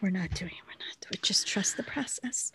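hushed whisper, almost breath only. Real, not acted. Cutting off a spoiler, whispered to co-host. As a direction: whisper it like a secret aside. Stops herself, hushed aside.

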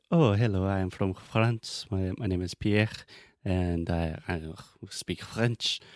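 English with a heavy French accent. Shown as a demonstration. Teacher imitating a French person speaking English, French accent. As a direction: put on a Frenchman's accent, slowing down. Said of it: "if I hear a French person speak English".

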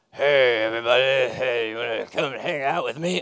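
a hint of the uncool adult trying too hard; creepy older guy. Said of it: "and he's this guy".

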